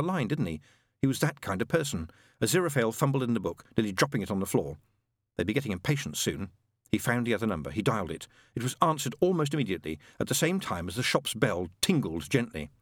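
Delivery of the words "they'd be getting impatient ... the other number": usual speaking voice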